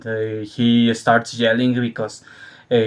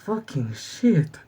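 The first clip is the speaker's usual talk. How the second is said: resigned mutter; 3 semitones higher, 8 dB quieter, slower than usual. Retold or imitated. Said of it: "and like".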